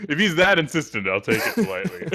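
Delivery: high, loud words through real laughter; laughing protest. Real, not acted. Player cracking up at the body-slam idea, laughing protest. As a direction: crack up while insisting.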